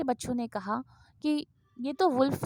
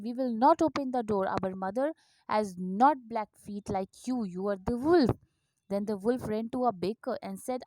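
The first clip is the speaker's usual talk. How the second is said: put-on slow, quieter reading to children; story reading; 7 dB quieter, much slower than usual. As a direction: read gently, slowly, for little ones. Slow English story reading, soft. (धीमी आवाज़ में धीरे-धीरे कहानी पढ़ना)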